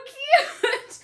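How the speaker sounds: high, slow, laughing "so cute"; laughing gush. Retold or imitated. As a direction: gush through laughter, stretching it out. Retelling her own gushing reply.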